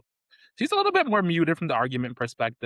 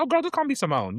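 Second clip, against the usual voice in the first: high, airy and casual; breezy casual friend; 13 semitones higher than usual. Retold or imitated. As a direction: toss it off like a laid-back friend. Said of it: "if you are Doctor Simone".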